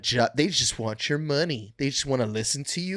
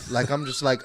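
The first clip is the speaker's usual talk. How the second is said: ordinary talk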